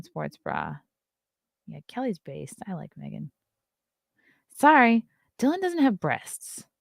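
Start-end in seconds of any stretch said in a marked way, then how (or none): none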